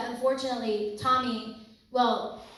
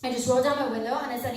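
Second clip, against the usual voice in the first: ordinary talk, nothing marked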